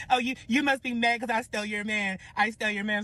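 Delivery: high, put-on gloating; gloating rival. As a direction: imitate the rival rubbing it in. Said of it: "She's laughing".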